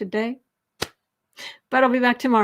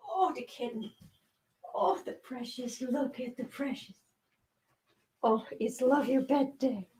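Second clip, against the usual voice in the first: soft cooing; 7 dB quieter than usual. Real, not acted. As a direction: murmur lovingly to the kitten. Cooing at a pet kitten.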